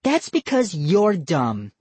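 put-on slow taunting girl voice; bratty little sister jab; snide girl. A narrator's reading. Molly insults cousin.

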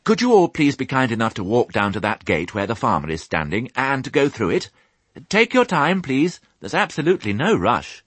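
high, polite, hurried plea; eager, slightly flustered little pig; hurriedly. A narrator's reading. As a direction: rattle off a courteous request. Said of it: "he said hurriedly".

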